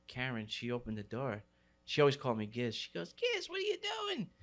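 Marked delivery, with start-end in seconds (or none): 3.1-4.3 very high-pitched imitation of a mother; surprised mom. Friend's mom opening the door to him, high surprised mom voice. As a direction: squeal it like a startled mom.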